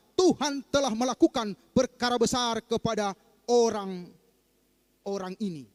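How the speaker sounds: loud proclaiming Bible reading; scripture reading. Acted. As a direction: proclaim the verse to the congregation. Pastor reads verse to congregation. (membaca ayat dengan lantang)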